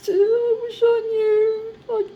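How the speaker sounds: high, slow, breaking into tears; crying. Real, not acted. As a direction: let the words crack into a sob. Grieving, questioning God.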